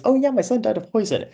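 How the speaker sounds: sweetly twist the knife in a high voice; Procne's taunt. Acted. Procne needles her guest in a high mocking voice. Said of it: "and then she says".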